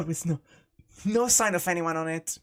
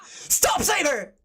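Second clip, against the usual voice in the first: high, urgent shout; screamed command; 10 semitones higher, 3 dB louder, much slower than usual. Acted. Shiro stops Saber from killing the magus, screaming. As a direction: scream it to halt an attack. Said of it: "I scream as loud as I can".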